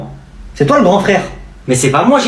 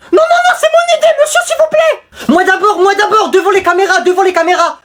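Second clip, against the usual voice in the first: put-on whiny kid shout; 12 semitones higher than usual. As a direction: beg to go first. Élève réclame la parole. (gamin qui supplie en criant, très aigu)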